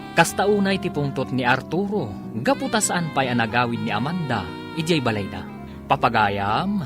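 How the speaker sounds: usual speaking voice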